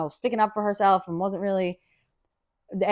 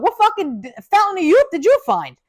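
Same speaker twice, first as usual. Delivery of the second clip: high, loud and amazed; marvelling to the roommate about her youthful looks; 10 semitones higher, 6 dB louder than usual. Retold or imitated.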